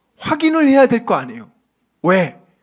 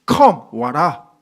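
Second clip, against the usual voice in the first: gentle call; 7 semitones lower, much slower than usual. Acted. Jesus voiced calling Peter.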